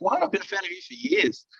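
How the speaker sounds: very high-pitched imitation of a stunned fan; mock disbelief. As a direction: squeal it in exaggerated astonishment. Imitating an astonished fan's reaction.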